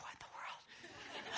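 hushed unvoiced whisper; doctor's stunned whisper; whispered aside. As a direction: mutter it under your breath, baffled. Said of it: "Doctor Gearhart's like".

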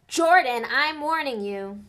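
stern warning. A narrator's reading. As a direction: threaten him, slow and firm. McGonagall scolding Lee.

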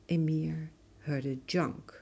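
plain reading voice

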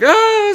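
short high-pitched mock scream; imitated scream. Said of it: "screaming and all that shit".

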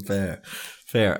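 ordinary talk

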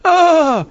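loud, high drawn "ahh"; high mock "ah" cry. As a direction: let out a playful high-pitched cry.

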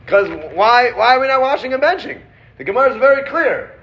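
playful exasperated rabbi baffled by his people; mock exasperation. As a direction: rattle it off in hammed-up disbelief. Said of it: "He's like".

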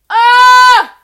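piercing high shriek; one full-force frustrated scream; blaring scream. Real, not acted. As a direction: let out an ear-splitting yell. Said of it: "this is blaring your ears".

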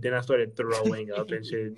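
normal talking voice

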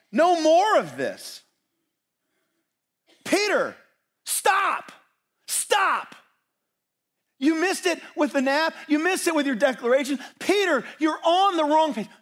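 shouted rebuke; high, loud command to stop, drawn out at first; Jesus. Acted. Jesus halts Peter after the sword attack, shouted rebuke. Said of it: "What does Jesus say?".

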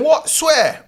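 high, loud stunned question; can't believe what he hears; shocked disbelief. Retold or imitated. Friend reacts in disbelief.

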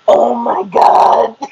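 loud, drawn-out cry of mortification; mortified. Retold or imitated. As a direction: groan it out slowly, utterly mortified. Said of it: "I was so mortified".

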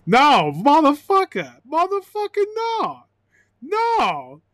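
high, swooping comic protest; hammed-up indignant squeal; mock outraged refusal. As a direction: burst out loud, then climb very high. Comic outburst on the swear word.

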